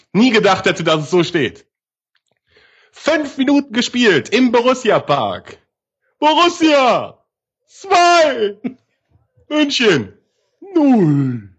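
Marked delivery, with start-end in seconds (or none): 2.9-11.5 score announcer. Acted. Mock solemn score reading.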